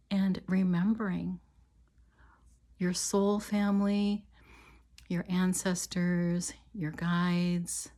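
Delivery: calm, measured meditation voice with long pauses; guided meditation. Real, not acted. Healer leading listeners on an imagined walk to a river, guided meditation. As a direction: guide them gently, leaving space after each phrase.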